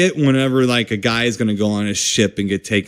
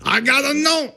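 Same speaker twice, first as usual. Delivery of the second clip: much higher, lightly put-on street voice; mock street voice; 14 semitones higher than usual. Joking about the film's name, a high put-on reply. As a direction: toss it off like a wisecrack. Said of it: "I keep wanting to say".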